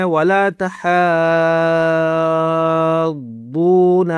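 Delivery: ولا تحاضون chanted with very long "haa" and long "doo"; stretched madd. Shown as a demonstration. Teacher showing what to stretch, ولا تحاضون drawn out slowly. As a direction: sustain both long vowels in ولا تحاضون. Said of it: "ضاد کو کھینچنا بھی ہے".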